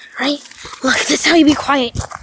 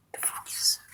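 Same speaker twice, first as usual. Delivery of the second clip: whispering; 19 dB quieter than usual. Real, not acted. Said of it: "I'm trying".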